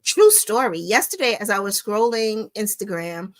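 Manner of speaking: ordinary talk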